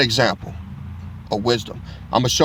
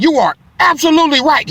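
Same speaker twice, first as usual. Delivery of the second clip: much higher, louder, overdone agreement; mock agreeable voice; 7 dB louder than usual. He disarms the angry officer, hammed-up high agreement. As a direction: sing out the agreement to disarm her. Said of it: "I said".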